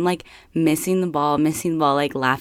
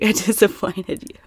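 laughing; 6 semitones higher, 4 dB louder than usual. Retold or imitated. Laughing apology to boyfriend.

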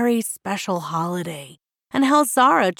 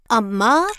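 firm, louder call with a rising end; 3 semitones higher, 6 dB louder than usual. A narrator's reading. Girl addressing her mother at breakfast, firm. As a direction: call to mum with resolve. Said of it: "Zara said firmly".

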